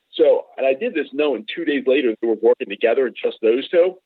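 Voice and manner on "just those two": normal talking voice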